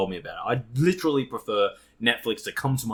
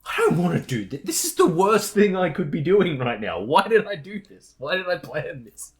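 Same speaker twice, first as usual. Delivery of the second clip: exasperated mock rant; 7 semitones higher, 5 dB louder than usual. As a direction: rail at your past self. Acting out regret at own plan.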